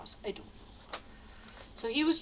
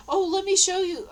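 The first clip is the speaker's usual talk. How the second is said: eager invitation; 9 semitones higher, 6 dB louder than usual. Retold or imitated. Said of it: "she's real happy".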